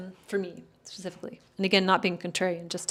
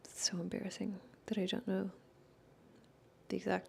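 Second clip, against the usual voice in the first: embarrassed whisper; 9 dB quieter than usual. Real, not acted. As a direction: drop to a self-conscious whisper. Embarrassed admission mid-stream.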